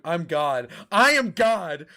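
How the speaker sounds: hammed-up deity decree; mock god voice. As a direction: proclaim yourself a god, hammed up. Mock divine proclamation.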